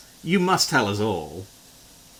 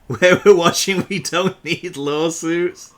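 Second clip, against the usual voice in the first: usual speaking voice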